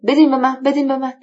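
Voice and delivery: quick, urgent demand. A narrator's reading. Hajji demanding the letter from the doctor, rapid-fire. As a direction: rattle it off, pressing for it. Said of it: "تون تون گفت".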